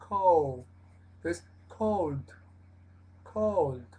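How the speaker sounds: "cold" with an open O and a relaxed U glide; American "cold", open and relaxed; "cold" a la norteamericana; North American pronunciation. Shown as a demonstration. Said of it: "la pronunciación más norteamericana".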